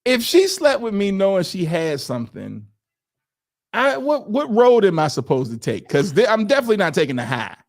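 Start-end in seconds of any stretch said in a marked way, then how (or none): none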